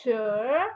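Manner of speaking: "Shir" drawn out, rising from low; second tone. Shown as a demonstration. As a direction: glide upward on "Shir". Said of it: "It's the second tone".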